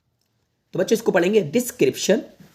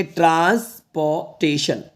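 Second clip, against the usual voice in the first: syllable by syllable; much slower than usual. Shown as a demonstration. Teacher reading a big word for students, transportation in parts. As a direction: read transportation out in slow, separate parts. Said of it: "ऐसे तोड़ के पढ़".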